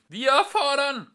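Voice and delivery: high, loud, slow shriek. A narrator's reading. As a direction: screech the demand. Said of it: "kreischte Frumpf von Deel".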